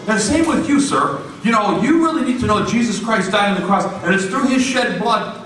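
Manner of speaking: fervent preaching. Retold or imitated. Miguel preaching at the worker, fervent. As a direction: preach it hard at him. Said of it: "Miguel's going".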